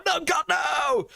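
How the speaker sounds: high, slow, drawn-out cry of despair; anguished shout. Real, not acted. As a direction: wail it out in agony. Reacting to a bad bean.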